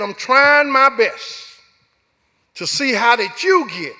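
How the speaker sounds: a mother at the end of her patience; exasperated mother. Retold or imitated. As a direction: play the fed-up mother, slow and loud. Mother scolding son, voiced.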